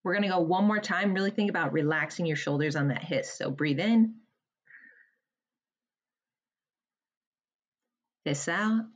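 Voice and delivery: pace the listener's breaths gently, one count at a time; guided breathing count. Acted. Leads a breathing exercise.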